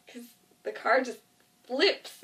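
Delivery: ordinary delivery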